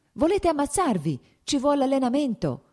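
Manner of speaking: alarmed shout at a reckless friend; shouted warning. Acted. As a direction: call out to stop him from a distance. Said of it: "gli gridò Nikolai Scerbački".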